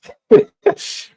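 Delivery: breathy high burst of laughter; high airy laugh. Real, not acted. Recalling his drinking days, airy high laugh. Laughing about past messy life.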